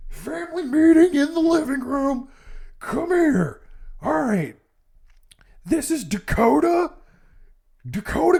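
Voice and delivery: high, loud hammed-up dad announcement; mock dad voice. Imagined dad calling the kids to the living room, high loud mock voice. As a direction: ham up a sleazy dad rounding up his family. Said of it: "imagine him being like".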